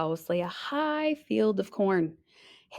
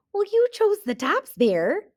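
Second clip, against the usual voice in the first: much higher, sing-song excuse; innocent hare; 12 semitones higher, 3 dB louder than usual. Acted. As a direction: play innocent sweetly.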